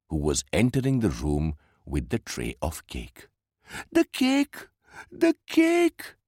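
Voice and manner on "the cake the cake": put-on very high-pitched child's cry of dismay; distressed exclamation. A narrator's reading. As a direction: cry out in alarm, higher the second time. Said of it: "exclaimed poor Meenu".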